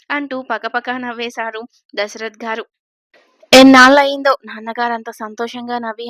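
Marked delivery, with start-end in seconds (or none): 3.5-6.1 loud, joyful outburst; happy exclaim. A narrator's reading. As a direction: call it out with delight, much louder. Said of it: "అన్నాడు శేఖర్ ఆనందంగా". (ఆనందంగా గట్టిగా)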